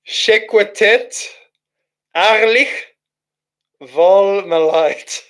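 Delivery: stifling a laugh over nonsense words; amused slow reading. Real, not acted. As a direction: sound out each strange word slowly, baffled and amused. Reads gibberish chat message aloud.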